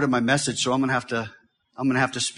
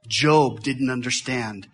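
ordinary talk

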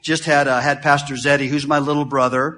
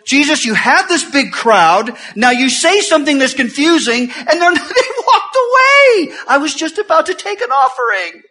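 mock-exasperated Peter, loud and high; a hammed-up frantic disciple fretting about the crowd; Peter complaining; 12 semitones higher, 7 dB louder than usual. Peter voiced complaining to Jesus.

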